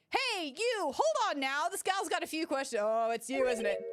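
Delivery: a hint of a boisterous country gal greeting an old friend; excited country gal. Voices Lotta's game lines.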